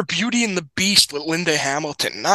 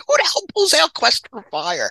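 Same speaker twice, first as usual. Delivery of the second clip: incredulous; 10 semitones higher than usual. Retold or imitated. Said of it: "And I'm like".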